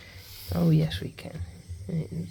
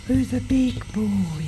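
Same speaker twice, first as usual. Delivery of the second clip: pet talk; 4 semitones higher, 5 dB louder, slower than usual. Real, not acted. Cooing to the iguana.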